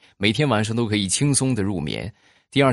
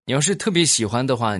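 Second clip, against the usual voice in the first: ordinary talk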